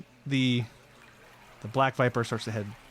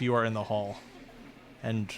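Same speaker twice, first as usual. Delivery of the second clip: ordinary talk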